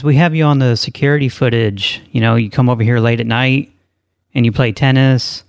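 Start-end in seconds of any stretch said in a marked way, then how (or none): none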